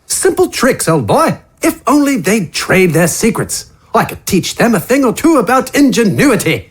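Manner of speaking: a boastful tinkerer hungry for secrets; Wither's voice. Acted. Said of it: "Wither's voice rasped with the thirst".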